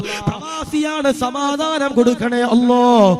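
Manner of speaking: loud, slow, pleading prayer chant; rising loud supplication; fervent dua. Acted. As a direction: beg God's blessing for the expatriate brother. Fervent dua, louder and drawn out.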